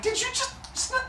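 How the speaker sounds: very high, soft disbelief; a high thin gasp of disbelief; shocked mother. Retold or imitated. Rhea seeing the second baby vanish, very high disbelief. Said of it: "Rhea's like".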